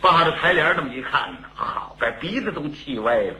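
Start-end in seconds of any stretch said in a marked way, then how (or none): none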